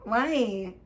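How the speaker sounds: usual speaking voice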